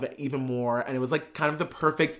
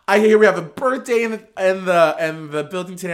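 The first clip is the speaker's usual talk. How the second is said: a louder, higher take on a drag queen's mic voice; bad Candy Muse impression; 4 semitones higher, 6 dB louder than usual. Retelling the show, a lightly voiced Candy Muse greeting the room. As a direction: play the host greeting the room. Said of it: "I don't do a good Candy Muse".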